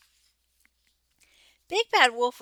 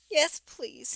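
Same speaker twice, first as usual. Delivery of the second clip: sniffling; 5 semitones higher, 4 dB quieter than usual. A narrator's reading. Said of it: "he sniffed".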